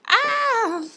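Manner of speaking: sharp high yelp of dismay; startled cry. Real, not acted. Reader yelping when a card jumps out.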